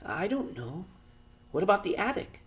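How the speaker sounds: put-on a boy searching for ideas; boy's voice. A narrator's reading. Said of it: "asked Pod".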